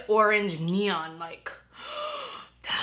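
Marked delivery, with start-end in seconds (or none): 1.8-2.4 gasp. Real, not acted. Excited over bright shade.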